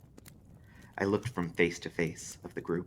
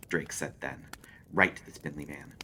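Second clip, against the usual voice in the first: plain reading voice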